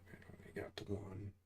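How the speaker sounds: unvoiced muttering to himself; whispered mutter. Real, not acted. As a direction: swear under your breath at the screen. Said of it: "whispers to self at game".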